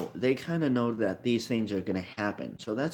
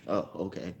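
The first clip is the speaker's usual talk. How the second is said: low-key dismissive scoff, softer and lowered; quietly scoffing at someone's card choice; mock scoff; 3 semitones lower, 6 dB quieter, faster than usual. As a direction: imitate an unimpressed player brushing it off. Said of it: "just scoff like".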